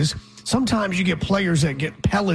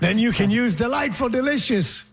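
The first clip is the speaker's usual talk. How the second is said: fake British accent; 7 semitones higher than usual. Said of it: "wanna use a fake British accent".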